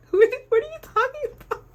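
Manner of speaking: very high, quick incredulous squeak of a question; baffled disbelief. Retold or imitated. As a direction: rush it out high-pitched, totally baffled. Acting out baffled reply to classmates.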